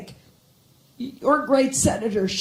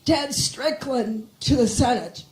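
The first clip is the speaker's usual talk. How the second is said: ordinary talk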